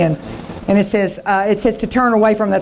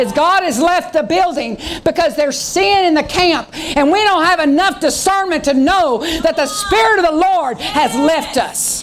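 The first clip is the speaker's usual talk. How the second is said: rising preacher's cry; 6 semitones higher than usual. Real, not acted. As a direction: preach it out to the congregation.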